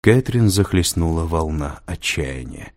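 ordinary delivery, nothing marked

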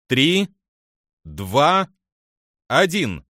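each number its own firm call; commanding countdown. Acted. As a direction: count down crisply to the order to fire.

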